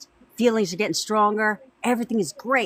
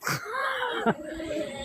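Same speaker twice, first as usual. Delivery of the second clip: laugh; 9 dB quieter than usual. Real, not acted. Laughs after acting girlfriend.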